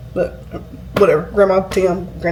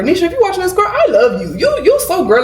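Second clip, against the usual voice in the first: much higher, bright call to her aunt; excited shout-out; 10 semitones higher than usual. Real, not acted. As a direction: greet her aunt through the camera.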